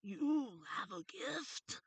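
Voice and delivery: high, loud, slow beckoning voice; drawn-out, urgent creature call with stretched vowels; Dark Crystal creature. Retold or imitated. Short gray-haired creature urging Kira, high loud creature voice. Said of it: "she said".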